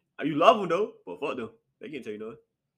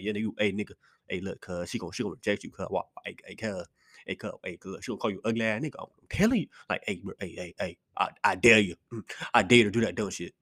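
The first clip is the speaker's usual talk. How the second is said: sneering inner voice; 5 semitones lower than usual. Acted. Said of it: "my brain be like".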